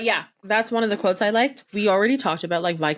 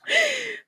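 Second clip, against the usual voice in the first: high gasp. Acted. Miming Anne Boleyn losing her head, gasping. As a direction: gasp as if struck.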